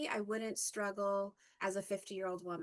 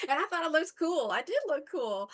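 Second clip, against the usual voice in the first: louder, fast, laughing and pleased with herself; laughing, proud; 6 semitones higher, 7 dB louder than usual. Real, not acted. To viewers about looking cool as a teen, laughing. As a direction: giggle through it, delighted.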